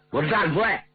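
indignant officer, pitch climbing high as he demands battle; angry thick-tongued officer. A narrator's reading. Angry officer urging the marshal to attack, indignant and high-pitched. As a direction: work up into a high-pitched, outraged plea to fight. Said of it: "说话也不清楚， 气得直晃脑袋".

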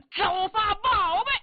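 high, loud and slow magic call; incantation shout. Acted. Yao Qi flinging the bowl skyward, slow high loud incantation. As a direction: drag out the spell as he throws. Hurling his bowl at the monster.

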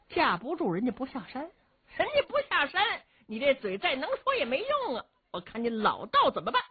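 high-pitched, lively needling; mocking taunt. A narrator's reading. Comrade needles the old Taoist about his failed plan, mocking. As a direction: jeer with rising glee.

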